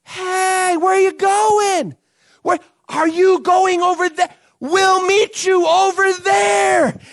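calling out. Pretend. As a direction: shout after the boat. Crowd calling after boat.

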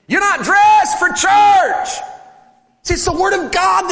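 high, drawn-out shout; mock rebuke. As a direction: holler it in mock outrage at the congregation.

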